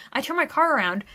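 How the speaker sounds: ordinary talk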